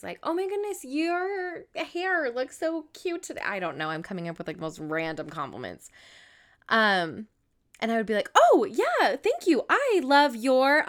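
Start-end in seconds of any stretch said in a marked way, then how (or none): 0.2-3.4 high, slow gushing over a friend's hair; gushing compliment. Retold or imitated. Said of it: "I have found myself doing this". 8.3-10.9 high, loud, flustered thanks that stalls on "your"; panicked gushing. Retold or imitated. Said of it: "panicking to find something".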